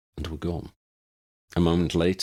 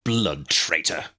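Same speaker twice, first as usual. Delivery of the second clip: snarl; slower than usual. Acted. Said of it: "his father snarled".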